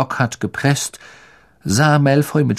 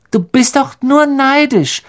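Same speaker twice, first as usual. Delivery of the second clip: put-on high, piping little-boy squeak; squeaky; 13 semitones higher, 4 dB louder than usual. Colin defends Harry against Malfoy, piping high. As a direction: pipe it up like a small boy. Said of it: "piepste Colin".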